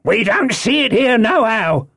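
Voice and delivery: high shouted reply from above; calling down. A narrator's reading. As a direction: holler it back from the window. Man shouts down from window.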